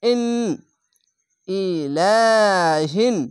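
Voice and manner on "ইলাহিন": slow intoned ইলাহিন, long held ending; Quran recitation. Shown as a demonstration. Teacher recites the word for the class, slow chant. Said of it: "খার জাবার এক আলিফ টেনে পড়বেন".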